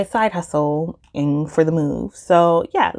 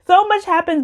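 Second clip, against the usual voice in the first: exclaimed much higher; 13 semitones higher, 3 dB louder than usual. Real, not acted.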